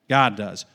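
low, firm answer; catechism answer. Shown as a demonstration. Preacher supplies the congregation's answer, catechism response. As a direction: drop "God does" well down, as the set response. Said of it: "I'm catechizing you".

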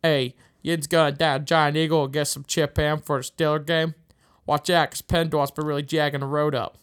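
loud Yinzer Pittsburgh accent; Pittsburghese accent. Shown as a demonstration. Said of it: "example of a Pittsburghese person saying".

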